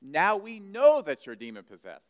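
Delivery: loud mock accusation; exclaiming. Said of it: "they exclaimed".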